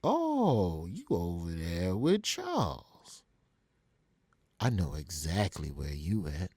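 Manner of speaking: long drawn-out realization, sinking low. Retold or imitated. Rapper working out where the pranksters are, slow menace. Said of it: "takes a deep breath".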